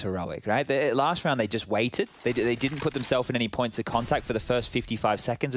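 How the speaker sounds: ordinary talk, nothing marked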